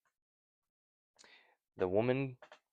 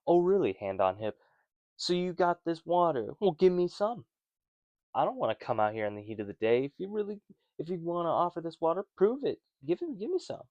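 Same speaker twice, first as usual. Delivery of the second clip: sassy and high, climbing louder; snarky; 7 semitones higher, 3 dB louder than usual. Retold or imitated. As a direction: hand on hip, challenge him to prove it. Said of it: "she's so snarky".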